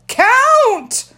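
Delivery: put-on high, loud little-boy yell; one-word childish shout; toddler shout. A narrator's reading. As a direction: bellow it like a bossy toddler. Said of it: "Fudge shouted".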